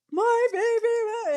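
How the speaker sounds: put-on high, slow wail of "My baby"; imitating her own sobbing plea; retold crying.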